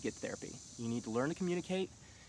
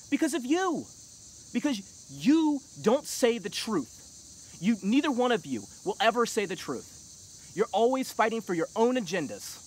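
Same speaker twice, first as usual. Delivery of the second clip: shouting high and loud, fired up; heated rant; 8 semitones higher, 7 dB louder than usual. Real, not acted. Scolding the nation's leaders, a heated high shout. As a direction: tear into them, loud and high. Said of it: "Sorry for getting a little heated".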